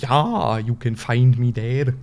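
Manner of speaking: loud, put-on German accent; hammed-up German, as Americans imagine it. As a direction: do a cheap stage German. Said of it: "I could do a German accent".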